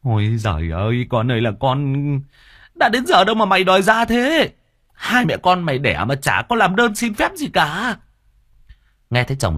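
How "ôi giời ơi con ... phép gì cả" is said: mock grumble, rising higher; a hammed-up grousing at the unborn child; grumbling husband. Husband grumbling at the baby coming early, mock grumble. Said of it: "Ông cằn nhằn".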